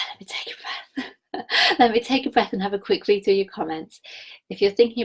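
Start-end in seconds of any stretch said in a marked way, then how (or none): 0.0-1.5 sighed out in a near whisper; breathy exhale. Real, not acted. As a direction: let the words out on a long, soft exhale. Pausing to catch breath.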